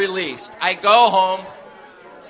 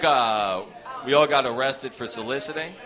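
1 sheepish low mumble; 6 semitones lower, 4 dB quieter than usual. Retold or imitated. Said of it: "one of the guys was like".

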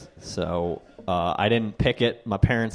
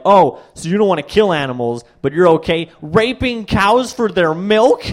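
mock indignant vegan; 5 semitones higher, 5 dB louder than usual. Retold vegan friend's accusation.

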